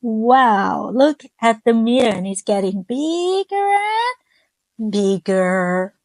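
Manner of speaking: usual speaking voice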